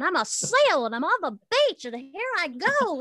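high, loud, silly seal character. Acted. Actress playing a seal on the beach, high silly seal voice. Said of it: "I was pretending to be a seal".

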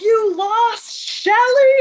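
shouted high and loud, slowly; mock shouting. Price yelling at Olivia from the box, loud. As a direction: yell the accusation at her. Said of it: "And he's like".

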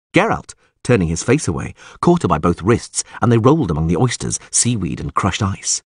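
ordinary delivery, nothing marked

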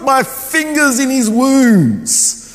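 high, drawn-out dramatic proclamation; hammed-up loud vow, every word stretched; extreme declaration. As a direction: declare it slowly with over-the-top defiance. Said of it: "he made these extreme statements".

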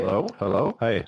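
crackling, distorted line; crackly audio. Real, not acted. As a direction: speak through a crackly connection. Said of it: "You're crackly".

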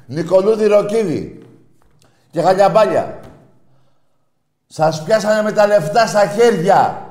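loud, high, angry tirade at rival fans; furious shouting rant. Real, not acted. Radio caller ranting loudly at rival fans. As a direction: shout him down like a furious fan.

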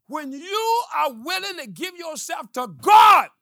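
high, loud, slow shouted preaching; full-force pulpit shout; preaching climax. Real, not acted. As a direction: hit the closing line like a preacher. Sermon climax.

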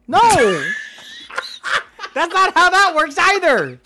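loud, high laughing protest; laughing shout. Real, not acted. Laughing outburst at mistake.

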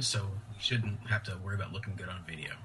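hushed joking aside; whispering. Acted. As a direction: whisper it like a secret.

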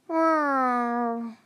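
long drawn-out plaintive animal moan; mournful sloth cry. A narrator's reading. Glory's sloth grieving the dead sloth, mournful cry. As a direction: let out a sad little creature wail. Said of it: "said Glory's sloth mournfully".

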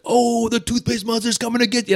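mock silly spooky dad; playful dad. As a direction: tease the kids like a fun dad. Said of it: "I would be like".

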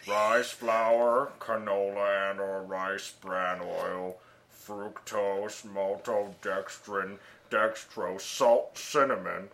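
deep, slow solemn chant; guru voice. Pretend. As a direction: read it like a droning guru. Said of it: "Wake up, guru".

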